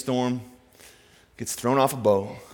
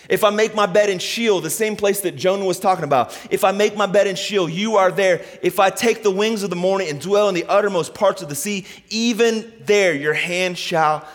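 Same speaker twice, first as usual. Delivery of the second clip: raised preaching voice; impassioned psalm reading; 7 semitones higher, 5 dB louder than usual. Real, not acted. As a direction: proclaim the psalm to the congregation.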